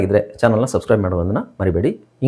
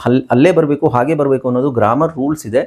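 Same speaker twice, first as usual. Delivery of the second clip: normal talking voice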